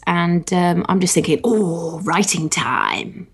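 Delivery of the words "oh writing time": very slow, drawn-out relish; savouring daydream. Acted. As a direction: stretch it out like a luxurious thought. Voicing her own happy thought.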